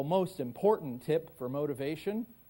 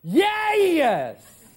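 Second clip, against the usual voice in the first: excited Southern accent; 12 semitones higher, 10 dB louder than usual. Shown as a demonstration. Kidnap victim greeting FBI rescuers, excited Southern accent. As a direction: holler it joyfully in the deepest Southern drawl. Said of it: "deepest, most southern accent you can muster".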